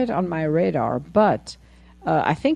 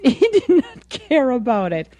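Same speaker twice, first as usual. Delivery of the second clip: laughing; 5 semitones higher, 4 dB louder than usual. Real, not acted. Laughing over the man's indifference.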